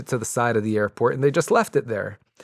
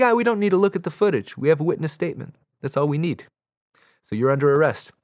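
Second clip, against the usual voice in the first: higher, fast, dismissive put-on cop voice; mock cop; 6 semitones higher, faster than usual. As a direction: brush him off like a bored cop. Cops dismissing his plea.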